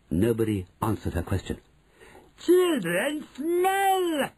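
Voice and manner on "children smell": high slow witch shriek; screaming witch. Acted. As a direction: drag out the shriek. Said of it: "she screamed".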